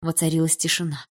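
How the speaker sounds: ordinary delivery, nothing marked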